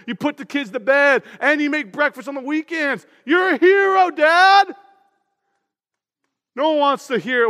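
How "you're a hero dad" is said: high, loud cheering praise; a bright burst of admiration for dad. Pretend. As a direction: cheer him on like a proud kid.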